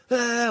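high drawn-out "aah"; mock flame-breathing "ah". Telling friends how he breathed blue flame, mock high "aah". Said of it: "like, I was breathing it".

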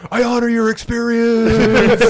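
slow, high, hammed-up sincere voice; mock earnest actor. Mocking the actor's solemn line to a friend. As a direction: ham it up like a self-serious actor.

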